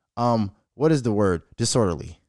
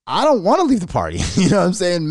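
ordinary talk

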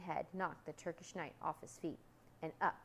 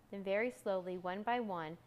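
ordinary delivery, nothing marked

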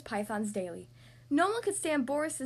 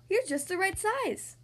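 high, drawn-out delight; amazed cry; 6 semitones higher, slower than usual. A narrator's reading. Two monsters find they dance well together, crying delightedly. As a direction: cry it out in happy discovery. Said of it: "they cried".

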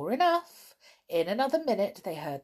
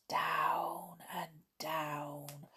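very slow, hushed narration; drawn-out descent; 8 dB quieter, much slower than usual. A narrator's reading. Narrator draws out the long descent.

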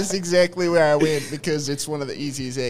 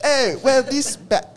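put-on much higher, louder slipping British accent; British accent sliding toward Southern; 11 semitones higher, 6 dB louder than usual. Host losing his British accent mid-pitch. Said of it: "It's turning into a southern accent".

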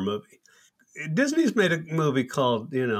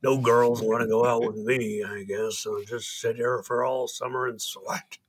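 imitating record-store guy; 2 semitones lower, 3 dB quieter than usual. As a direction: imitate a sweaty loser moaning about his lot. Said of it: "he would do this imitation".

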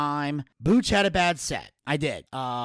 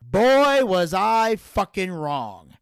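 loud exclamation; 4 semitones higher, 6 dB louder, much slower than usual. Real, not acted. Telling listeners how wrong he was, loud then slow. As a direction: burst out loud, then slow right down on the admission. Realizing the set went badly.

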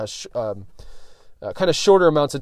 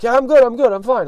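higher, louder voiced friend insisting he's fine; mock friend brushing it off; 9 semitones higher, 5 dB louder than usual. Friend who passed out insists he's good, higher and louder. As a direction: play the guy waving off concern.

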